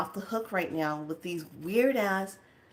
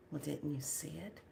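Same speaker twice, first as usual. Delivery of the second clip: very quiet, lower imitated reply; sly soft question; 3 semitones lower, 14 dB quieter than usual. Door lady answering about misty room, sly soft question. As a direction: ask it softly, as if sharing a secret.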